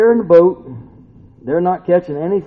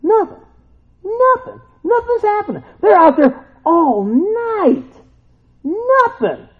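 drawn-out, much higher mock lament; weary fishermen; 4 dB louder, slower than usual. Preacher voicing fishermen's empty nets, high mock lament. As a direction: moan it like a fruitless night. Fishermen catching nothing all night.

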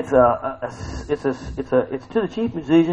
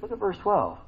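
usual speaking voice

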